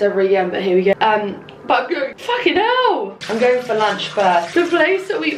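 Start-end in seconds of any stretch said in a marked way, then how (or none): 2.2-3.2 high, slow drawn-out exclamation; a surprised swear pulled long; startled curse. Real, not acted. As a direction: blurt it as something catches you. Sudden exclamation mid-sentence.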